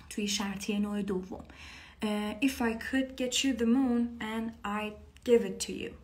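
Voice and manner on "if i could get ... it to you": slow lyric. Shown as a demonstration. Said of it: "قبلاً با هم خونده بودیم".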